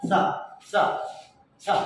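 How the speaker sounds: "সা" as a short voiceless English th syllable; three separate short "সা" with tongue-tip th; th sound demo. Shown as a demonstration. Teacher showing a sound Bangla lacks, short th syllables. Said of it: "উচ্চারণ হবে হচ্ছে এরকম".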